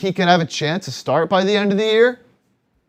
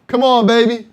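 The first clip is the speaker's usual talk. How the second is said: loud excited cheering, higher pitch; frat bro; 6 semitones higher, 5 dB louder than usual. Real, not acted. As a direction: shout him home like a race caller. Cheering the chugger on live.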